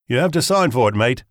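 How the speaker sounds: high, loud British courier patter; British accent. A narrator's reading. Fake courier insists on a signature, British accent. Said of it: "In my best British accent".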